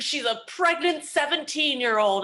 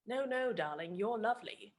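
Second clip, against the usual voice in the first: gentle star; 9 dB quieter than usual. Retold or imitated. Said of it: "he goes".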